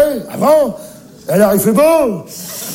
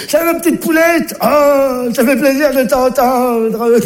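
delighted exclaim; 5 semitones higher, slower than usual. Acted. Grandpa delighted on phone.

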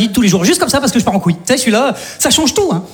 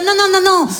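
scared neighbour; 9 semitones higher than usual. Retold or imitated. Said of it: "Il était là".

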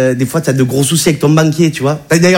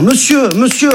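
high, fussy conductor voice; mock ticket inspector fussing; train conductor voice; 7 semitones higher than usual. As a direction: play the conductor tapping the man's hand, pitched up. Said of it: "en disant".

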